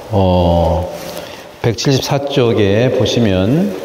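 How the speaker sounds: ordinary talk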